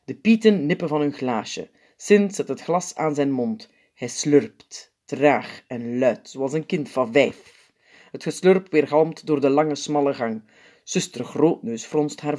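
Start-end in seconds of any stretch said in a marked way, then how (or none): none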